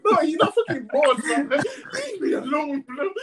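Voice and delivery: high, laughing plea to be left alone; laughing exasperation. Retold or imitated. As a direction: laugh through the complaint. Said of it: "I'm thinking".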